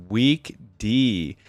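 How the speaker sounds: ordinary talk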